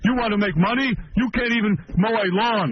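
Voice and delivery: hammed-up griping dad; mocking dad. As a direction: whine it like a fed-up father. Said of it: "they would discuss in front of me".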